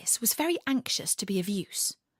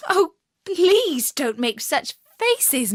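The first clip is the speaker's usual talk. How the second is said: high, slow, loud plea; crying out; 7 semitones higher, 7 dB louder, slower than usual. A narrator's reading. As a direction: beg him to stop, laughing at him. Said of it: "she cried out".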